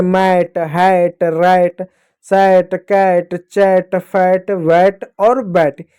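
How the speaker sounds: each word from "मैट" to "बैट" with the same open ai; "ai" vowel drill. Shown as a demonstration. Teacher drilling rhyming words for pupils, same open ai from "मैट" to "बैट". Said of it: "सारे शब्द इसी प्रकार पढ़े जाएंगे".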